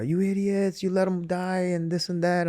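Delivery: high-pitched mock yell at the wrestlers; half-hearted imitation of a furious shout; retold angry yell. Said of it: "people screaming at the wrestlers".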